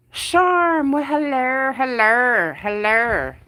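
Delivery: high nasal-ish sing-song "hello" imitation, loud at first; hammed-up sitcom greeting, pitch falling; Janice from Friends. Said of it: "Janice from Friends, yes".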